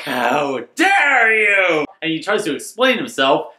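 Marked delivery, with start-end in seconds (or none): 0.0-1.8 mock outrage. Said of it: "she storms off like".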